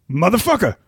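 high, tough movie-gangster voice; Samuel L. Jackson impression. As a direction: growl it like a badass gangster. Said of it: "speed round".

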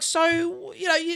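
mock the prime minister, pitched high and talking down; patronising prime minister voice. Mocking the prime minister's patronising voice to Rogan. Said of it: "stop fucking talking in that voice".

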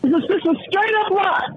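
high-pitched, exasperated insistence; indignant outburst. Real, not acted. Outraged repeat of the accusation.

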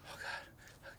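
barely audible, under the breath; retold frozen-in-fear whisper; hushed dread. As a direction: let it out as a tiny whisper. Frozen, seeing the shadow figure.

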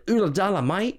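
a half-hearted mock Aussie greeting; fake Australian accent. Retelling a bar encounter, a light fake Australian accent. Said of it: "pretending he's an Australian".

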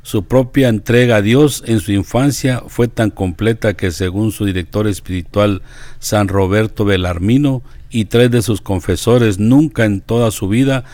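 measured devotional biography narration; deliberate reading with pauses between phrases; reading aloud. Acted. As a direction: read it out clearly, phrase by phrase. Reads a saint's life aloud.

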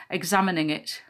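ordinary delivery

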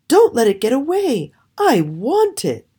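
high, insistent princess; demanding cry. A narrator's reading. Princess wanting the bird caught, crying out demandingly. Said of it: "she cried".

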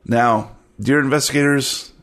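usual speaking voice

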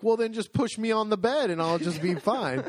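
retold pleading comeback; exasperated retort. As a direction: insist on the obvious solution. Retold argument with the nurse.

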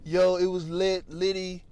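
lightly mocking young kid slang; mock young guy. Mock teen slang, a comic distancing himself from young talk. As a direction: toss off lit like a teen. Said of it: "I'm not gonna be like".

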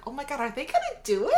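fast, high and laughing, caught up in the moment; excited disbelief. Retold or imitated. Podcaster reliving the landing scene, fast, laughing excitement. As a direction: gasp it out like the plane might really land.

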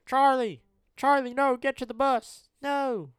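frantic yell, very high and loud. Retold or imitated. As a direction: scream his name in panic. Yelling movie scene.

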